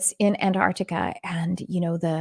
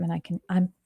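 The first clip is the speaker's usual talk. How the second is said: ordinary talk, nothing marked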